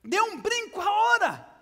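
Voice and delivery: shouted father's order; father yelling. Acted. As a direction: urge him to leap now. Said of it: "Y el papá gritaba".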